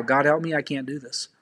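soft, dropping prayer, fading to almost nothing; a hushed plea trailing off; quiet prayer. Shown as a demonstration. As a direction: pray it low and small, giving up. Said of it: "this is how".

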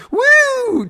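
very high, loud "woo"; obnoxious whoop. Retold or imitated. Runner taunting the driver, obnoxious high whoop. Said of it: "Like a really obnoxious".

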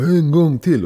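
retold crowd call. Concertgoer calling the band back, retold mock shout. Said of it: "står man och ropar".

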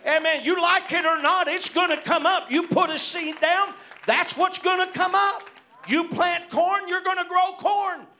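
loud, high, hammering pulpit cadence; full-force preaching. Acted. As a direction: preach it to the rafters, amen-style.

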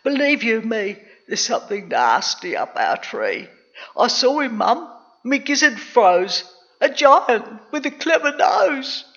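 put-on frightened boy, pitch swooping up; gasped. A narrator's reading. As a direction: report the horror to mum in awe. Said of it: "he gasped".

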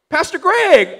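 high-pitched mock girl's greeting; imitated surprised girl. Girl spots her pastor at the gym, imitated surprise. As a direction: imitate a girl crying out in recognition. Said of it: "The girl next to her goes".

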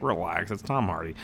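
low, soft reassurance; calm dismissal. Retold or imitated. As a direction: brush off the fuss quietly. Calm reply to complaining fans.

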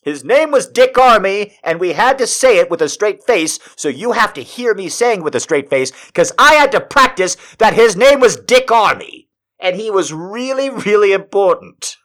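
high, loud, insistent ranting; worked-up indignation, voice pushed high; exasperated rant. Acted. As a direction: hammer it home in comic outrage. Escalating rant over a politician's name.

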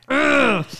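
imitating a worn-out parent's groan; exasperated ugh. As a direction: let out a fed-up parent's ugh. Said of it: "'Cause you're just like".

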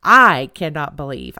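"I" punched loud and long in "I cannot believe"; stress on I. Retold or imitated. Re-enacting the rant from the other day, stress on "I". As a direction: hit "I" hard, then run low through "cannot believe". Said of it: "I said it every conceivable way".

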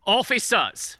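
ordinary delivery

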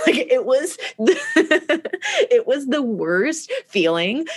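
high, laughing through the words; slow, drawn-out words broken by laughter; real laughing. Real, not acted. Laughs at own failure.

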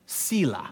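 "Sela" said as SEE-la; long ee in the first syllable of "Sela"; variant pronunciation. Shown as a demonstration. Showing how some people pronounce it, "Sela" as SEE-la. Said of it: "Some people pronounce it".

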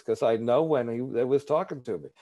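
ordinary talk, nothing marked

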